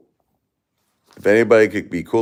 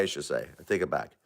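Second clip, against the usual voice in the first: much softer, lower self-correction; quiet aside; 4 semitones lower, 14 dB quieter, faster than usual. Real, not acted. Correcting himself to viewers, suddenly soft and low. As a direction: drop to a hushed correction.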